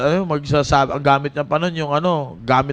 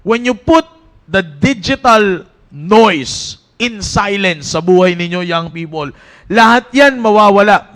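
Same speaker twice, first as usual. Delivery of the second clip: raised, urgent preaching to the youth; 4 semitones higher, 4 dB louder than usual. Acted. Youth pastor exhorting Team Focus, preaching with force. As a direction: drive it home to the young people.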